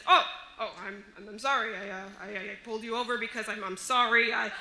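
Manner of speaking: stammering, apologetic retold cop; flustered officer. Officer who mistook a woman for a man, flustered apology. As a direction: stumble over a string of false starts. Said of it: "He comes up to me and says".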